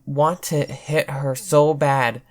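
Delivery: ordinary delivery, nothing marked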